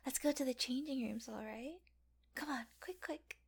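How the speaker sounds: normal talking voice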